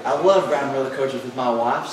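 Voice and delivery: ordinary talk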